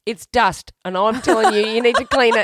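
loud, playful telling-off; mock scolding. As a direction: scold her like a fussy housemate, all in fun. Teasing scold about dusty piano.

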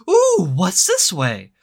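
high, slow, wide-eyed wonder; mock curious explorer, slow and high. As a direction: coo it like a nosy kid finding a path. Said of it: "curious explorer anyway that's like".

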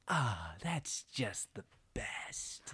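slow, breathy whisper of relish; hushed, drawn-out blissful gloat; twisted satisfaction. Retold or imitated. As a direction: whisper it, savoring every word. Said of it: "twisted display of satisfaction on her face".

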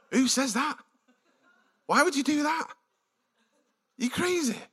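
Mary mocking the angel's clumsy greeting; unimpressed. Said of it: "you can almost hear her".

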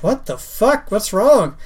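startled, high-pitched question; surprised. Retold or imitated. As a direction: ask it in sudden alarm. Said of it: "I'm like".

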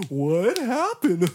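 high, drawn-out question through laughter; friends laughing. Retold or imitated. Imitating friends teasing him after his flop, slow laughing question. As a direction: imitate buddies cracking up as they ask. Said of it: "These dudes are like".

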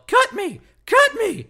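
high-pitched, loud mock shouting; shrill-pitched retold game yell; imitated yell. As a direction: yell it out like someone calling to a partner mid-game. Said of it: "you would just hear each other yell".

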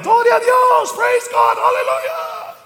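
high, drawn-out mock hallelujah shouting; ecstatic praise shout. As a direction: imitate a congregation crying out, very high. Crowd cheering in praise.

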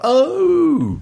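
high startled oh; surprised oh. Retold or imitated. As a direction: mimic a stunned recognition. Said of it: "suddenly it was like".

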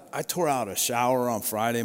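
usual speaking voice

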